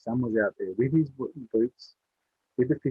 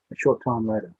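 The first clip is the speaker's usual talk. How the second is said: usual speaking voice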